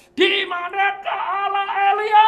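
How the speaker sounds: loud, high anguished shout; desperate cry to God. Retold or imitated. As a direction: hurl the question across the river.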